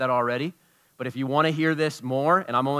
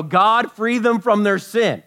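loud, higher pleading priest; priest's plea; 6 semitones higher, 6 dB louder, slower than usual. Retold or imitated. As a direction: cry out to God for them. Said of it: "and then go".

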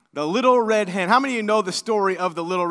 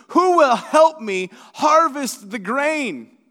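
slow, high call to the whole barnyard; storybook hen calling for volunteers; 4 semitones higher, slower than usual. Acted. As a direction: call for helpers, drawing the words out. Hen calls to barnyard friends.

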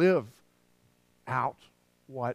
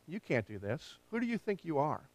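demoralizing inner voice; 7 dB quieter than usual. Retold or imitated. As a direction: say it quietly, like a doubt in the head. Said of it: "they demoralize us".

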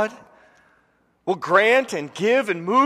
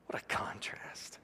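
breathy whispered aside; 18 dB quieter than usual. Real, not acted. Preacher to congregation, whispering. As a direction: whisper it to the room. Sermon hush.

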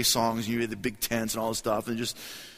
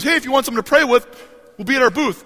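lead singer invite; 13 semitones higher, 12 dB louder, faster than usual. Retold or imitated.

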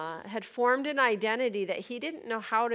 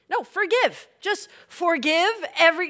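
raised, high-pitched exhortation; emphatic urging; 8 semitones higher, 7 dB louder than usual. Real, not acted. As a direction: push them hard to just forgive. Preacher urging listeners to forgive.

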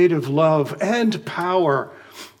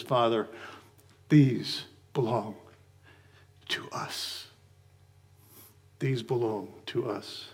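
tender plea; 6 semitones lower, 9 dB quieter than usual. Acted. Jesus voiced pleading before the Father.

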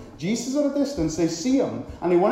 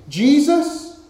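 raised pleading call; crying out; 4 semitones higher, 5 dB louder, much slower than usual. Acted. Preacher voicing lepers begging Jesus, raised pleading call. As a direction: call out to be noticed. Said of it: "They cry out".

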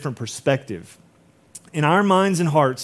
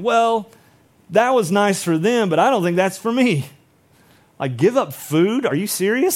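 higher-pitched incredulous brush-off; mock dismissive; 5 semitones higher, 3 dB louder than usual. Preacher voicing Westerners who read of fasting, mock dismissive. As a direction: play the sceptic who wants no part of it.

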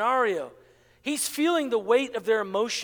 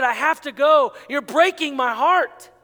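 anguished declaration; 4 semitones higher, 8 dB louder than usual. Acted. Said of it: "when he said".

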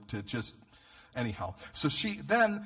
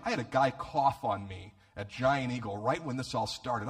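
normal talking voice

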